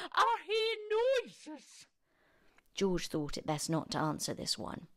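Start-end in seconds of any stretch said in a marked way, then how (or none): 0.1-1.9 put-on high, suspicious old granny. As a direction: accuse him like a cranky grandmother. Granny calling from next room.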